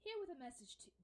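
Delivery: a hint of the dwarf voice, slipping; dwarf messenger voice. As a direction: try to find the dwarf voice again. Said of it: "Fuck, I lost the voice".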